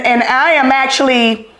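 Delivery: ordinary talk, nothing marked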